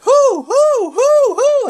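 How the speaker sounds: loud, very high hoots; high rhythmic hooting. Retold or imitated. Mimicking the New Day's chant, high hoots. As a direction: hoot it like a chanting crowd. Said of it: "And they're like".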